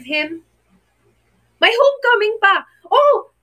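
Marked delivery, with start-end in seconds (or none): 1.6-3.3 high, loud burst of surprise; sudden excited realization. Real, not acted. Housemate realizing on her live that the homecoming is near, surprised excited cry. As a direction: it just hit you that the reunion is coming.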